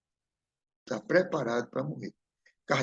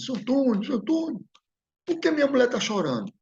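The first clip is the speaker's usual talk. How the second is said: high, rising, panicked pleading that builds to a shout; a confused dead man calling out, growing frantic until he yells; desperate ghost's cry; 11 semitones higher, 3 dB louder than usual. Retold or imitated. Dead neighbor calling to Antônio at his own funeral, panicked high shout. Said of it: "E começou a gritar".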